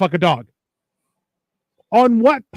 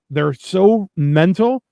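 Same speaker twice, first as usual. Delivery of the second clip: usual speaking voice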